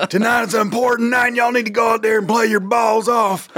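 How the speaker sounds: high, rousing Southern football coach; hammed-up Southern pep-talk shout; Southern accent. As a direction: fire up the team in a Texan twang. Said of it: "get a job as a football coach".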